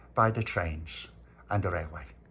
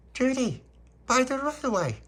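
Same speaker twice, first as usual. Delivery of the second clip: very high, startled question; surprised child; 14 semitones higher than usual. Retold or imitated. Little boy surprised the wood is by the railway, high squeak. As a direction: squeak it in disbelief.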